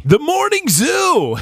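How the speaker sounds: high, slow hyped morning radio host. Pretend. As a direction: announce it like a wacky morning DJ. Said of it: "You could take that nationally".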